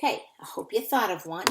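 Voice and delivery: ordinary talk, nothing marked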